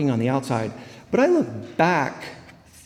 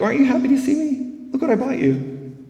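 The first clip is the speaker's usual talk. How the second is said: higher, bright-eyed show-off; imitating her past self, higher and excited; mock eager delight; 11 semitones higher than usual. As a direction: hold up the gift, eager to please. Proud surprise with champagne.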